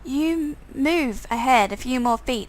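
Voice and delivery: normal talking voice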